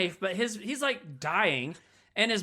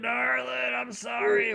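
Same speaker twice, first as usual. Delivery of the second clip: dying apology; 5 semitones higher, slower than usual. Retold or imitated. Dying man apologizing to her.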